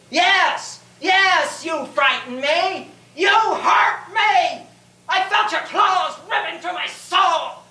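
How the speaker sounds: high-pitched cry of hurt, building to a shout; anguished outcry. Acted. Knight confessing his hurt to the dragon, anguished cry. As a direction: cry out the pain at your tormentor.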